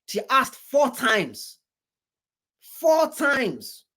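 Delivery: FOUR TIMES drawn out slowly and pitched high; emphatic repetition. Real, not acted. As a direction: stretch "four times", high and falling, to drive it home. Repeats count to drive it home.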